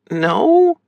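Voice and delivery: high, sad little question; saddened sister's high voice. A narrator's reading. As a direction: ask it high, disappointed. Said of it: "Ruth sounded sad".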